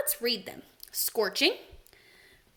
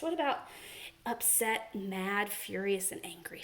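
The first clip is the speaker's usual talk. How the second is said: softer and softer, ending whispered; trailing off into a hush; fading to a whisper; 4 semitones lower, 9 dB quieter than usual. Shown as a demonstration. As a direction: let the list die away to a whisper. Listing choices, fading to whisper.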